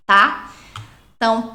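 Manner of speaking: ordinary talk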